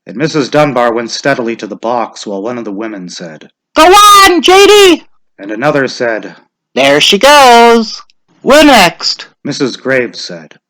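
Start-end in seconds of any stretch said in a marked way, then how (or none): 3.8-5.0 much higher, louder urging; high loud woman's call; encouraging village woman. A narrator's reading. 6.7-9.3 much higher, slow woman's voice; slow high old woman; old village woman. A narrator's reading. Mrs Graves voice.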